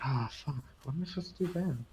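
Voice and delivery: ordinary talk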